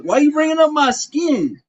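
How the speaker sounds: high, loud indignant yell at a viewer; angry shout. Real, not acted. Yelling at a chat viewer who brought up his skin, angry. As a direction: shout it back, offended and loud.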